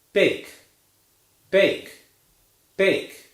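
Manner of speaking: "bake" with a clear gliding A diphthong; slow teacher model of "bake"; model word bake. Shown as a demonstration. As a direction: demonstrate "bake", vowel gliding from eh to ee. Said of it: "let's try a few words now".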